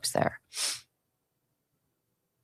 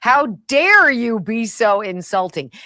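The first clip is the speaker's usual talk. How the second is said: slow, louder hammed-up outrage; mock indignation; 6 semitones higher, 5 dB louder, slower than usual. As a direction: ham up the offended official, slowly. Mocking indignant official.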